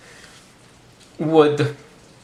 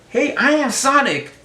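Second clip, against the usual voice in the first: raised, perky cartoon hedgehog; a light mascot voice pitched up; Sonic voice; 8 semitones higher than usual. Retelling a Japanese ad, lightly voiced Sonic. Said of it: "and it says".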